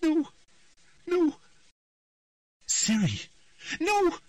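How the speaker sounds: high, rising panic; a child lost in a nightmare, pleading; frightened girl. A narrator's reading. Ciri's frightened trance speech.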